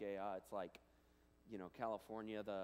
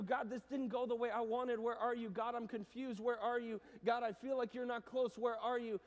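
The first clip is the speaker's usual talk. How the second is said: loud, high pleading cries to God; desperate voices calling on God; pleading prayers; 12 semitones higher, 10 dB louder than usual. Acted. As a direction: cry out each plea. Voicing people's pleas to God.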